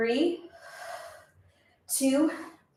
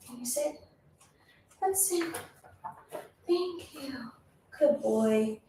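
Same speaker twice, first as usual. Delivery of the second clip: talking to the dog; 10 dB quieter than usual. Real, not acted. Speaking to her dog.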